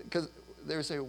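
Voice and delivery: ordinary talk, nothing marked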